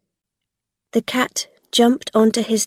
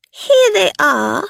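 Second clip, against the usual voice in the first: high, louder woman's voice; Belle's voice; 9 semitones higher, 5 dB louder than usual. A narrator's reading. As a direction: announce them in a high sly tone. Belle announcing the children to Gog.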